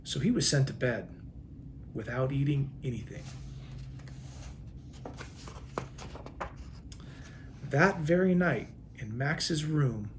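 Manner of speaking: gentle storybook read-aloud with long pauses; reading to children. A narrator's reading. Police chief reading a picture book to children, storytelling voice.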